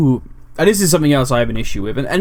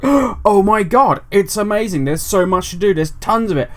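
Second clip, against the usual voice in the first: higher, slow gushing oh my god; mock gushing; 5 semitones higher than usual. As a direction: rave about it like you can't contain it. Retelling excitement to his wife.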